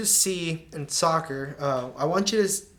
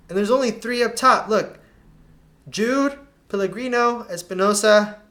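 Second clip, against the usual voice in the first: excited pointing out; 6 semitones higher, 7 dB louder than usual. Real, not acted. Coach counting the players left up top.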